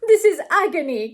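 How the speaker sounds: loud, high cry of agony; agonized cry. A narrator's reading. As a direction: cry out like a tormented lover. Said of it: "cried Mister Saltiner".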